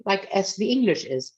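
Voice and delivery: ordinary talk, nothing marked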